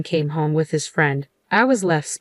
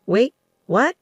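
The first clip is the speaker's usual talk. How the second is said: higher puzzled "wait, what?"; puzzled surprise; 6 semitones higher than usual. A narrator's reading. Son hears of the thousand-dollar transfers, puzzled. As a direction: react in confusion, pitch jumping up. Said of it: "Both of us were puzzled".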